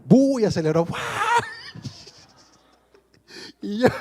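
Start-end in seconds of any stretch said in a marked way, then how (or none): 0.1-0.4 loud, high imitated roar of a car speeding off; mock engine noise, loud; engine burst. 1.0-2.1 high, squealing real laugh; real laughter, pitched way up; burst of laughter. Real, not acted. Laughing at the memory.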